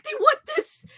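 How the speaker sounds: bewildered printer. Pretend. Voicing baffled printers.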